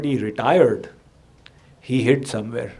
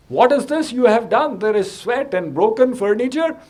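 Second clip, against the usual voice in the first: angry scolding; 8 semitones higher than usual. Acted. Priests scold the acrobat.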